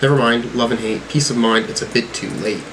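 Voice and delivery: usual speaking voice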